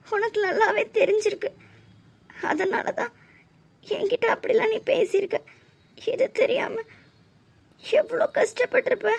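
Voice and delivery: high, halting, upset voice; hurt realisation. Acted. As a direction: let the hurt rise as it sinks in. Hurt friend realises she was not told. (மனம் உடைந்த குரலில்)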